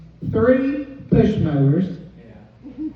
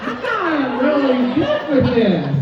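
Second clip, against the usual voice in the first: a touch higher, mock worried; worried inner voice; 6 semitones higher than usual. Wife thinking husband is changing, a hint of mock worry. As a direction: think it with mild alarm. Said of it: "And I thought".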